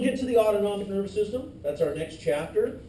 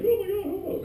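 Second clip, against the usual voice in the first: mock panic; 12 semitones higher than usual. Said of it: "They're like".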